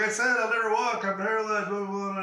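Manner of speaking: high-pitched wailing retort; mock tearful protest. Injured player to coach, high mock wail. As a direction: whine it, pitched well up.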